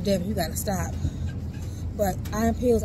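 ordinary talk, nothing marked

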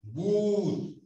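GOOD stretched into a long, rounded "oo"; kiss-shaped lips, hold the vowel in GOOD; labios en trompita. Shown as a demonstration. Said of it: "estiren la trompita". (GOOD con U larga, posición de beso)

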